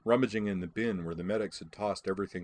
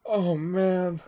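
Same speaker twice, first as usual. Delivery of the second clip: high, loud gasp of amusement; acted breathless hilarity; gasped after laughter; 11 semitones higher, 7 dB louder than usual. A narrator's reading. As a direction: gasp it out as if recovering from helpless laughter. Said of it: "she gasped when she could talk again".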